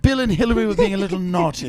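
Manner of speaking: put-on upper-class British lilt; British accent. Shown as a demonstration. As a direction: gossip teasingly in a British accent. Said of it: "regal kind of British accent".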